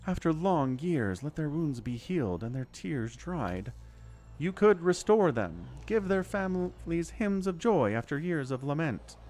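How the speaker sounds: lightly voiced goddess, tender. Reads the goddess's lines aloud.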